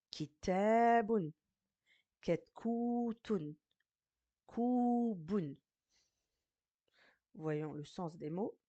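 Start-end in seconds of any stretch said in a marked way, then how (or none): 0.1-5.6 slow, with the long vowel held high in "Kitabun", "Ketkutun", "Kubun"; drawn-out reading. Shown as a demonstration. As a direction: recite each word slowly, stretching its long syllable. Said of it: "Je relis".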